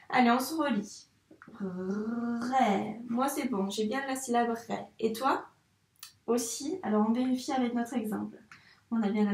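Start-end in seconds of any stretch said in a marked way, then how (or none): 1.6-3.0 RÉ with a long R and held vowel; teacher's drawn-out RÉ; sounding out RÉ. Shown as a demonstration. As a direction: stretch both sounds of RÉ. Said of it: "il faut bien dire tous les sons".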